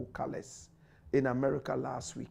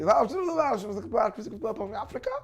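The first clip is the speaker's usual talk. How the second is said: mock American question; 5 semitones higher, 3 dB louder, much slower than usual. American asks him at college.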